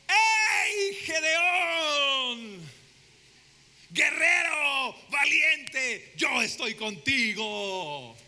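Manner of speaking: high, loud, slow divine shout calling his name; booming shout of God. Acted. Preacher acting God calling Gedeon, booming shout. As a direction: call out like God thundering from above. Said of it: "Yo me imagino a Dios apareciéndole".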